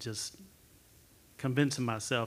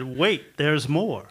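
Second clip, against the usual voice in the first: loud, punchy infomercial pitch; infomercial salesman; 3 semitones higher, 9 dB louder, much slower than usual. Acted. Class member mimics fear as a salesman, loud infomercial pitch. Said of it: "or infomercial. The ones that said".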